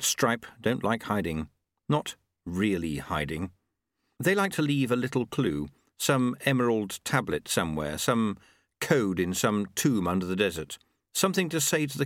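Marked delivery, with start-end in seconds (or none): none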